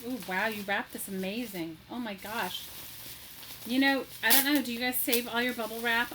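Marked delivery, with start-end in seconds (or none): none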